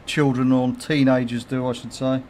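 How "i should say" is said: ordinary delivery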